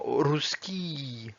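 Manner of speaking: "Ruski" with a long final vowel; drawn-out Russian ending. Shown as a demonstration. Teacher giving an example word, "Ruski" ending drawn out. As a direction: stretch the last vowel of "Ruski". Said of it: "Ça se prononce vraiment I".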